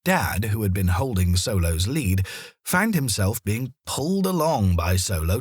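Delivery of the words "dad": ordinary delivery, nothing marked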